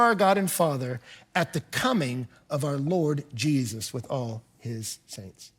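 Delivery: soft, slowing end of the verse; reverent close. Acted. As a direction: let the blessing settle reverently.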